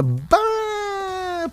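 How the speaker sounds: long, high, thin "Bah" of dismay; disapproving "Bah". Shown as a demonstration. Friend reacting to someone skipping the night out, high drawn-out dismayed "Bah". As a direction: wail "Bah" softly on one held high note. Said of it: "você tá meio que reprovando o negócio".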